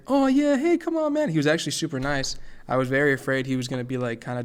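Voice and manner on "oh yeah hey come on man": high loud friendly impression; imitating actor. Actor greeting a nervous fan, high friendly mock voice. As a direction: pitch way up, breezy and welcoming. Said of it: "then he's like".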